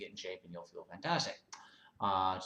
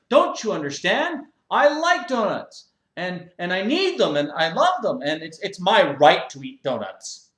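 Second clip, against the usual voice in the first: high, loud self-righteous whine; whiny gym member; 6 semitones higher, 12 dB louder than usual. Acted. Member defends his mess to the owner, loud high whine. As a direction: complain like a spoiled man-child.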